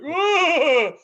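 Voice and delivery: high, loud startled whoa; mock shock. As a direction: cry out in stunned shock. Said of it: "I'm like".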